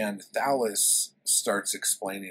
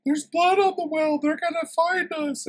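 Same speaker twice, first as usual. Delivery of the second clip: very high, loud hysterical falsetto; over-the-top squealing panic; panicky damsel; 9 dB louder than usual. Retold or imitated. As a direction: shriek it as a mock-terrified girl. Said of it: "And Natala's like".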